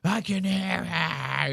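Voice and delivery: slowed, dazed mock of a man losing it; a slow, spooked imitation of the homeowner; mimicking the frantic guy. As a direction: echo him like he's hearing voices. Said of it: "He's, like, losing his mind".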